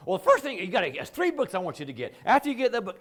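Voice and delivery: hammed-up loud eager helper; pushy fixer. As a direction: barrel in like a know-it-all fixer. Acting pushy fixer friend.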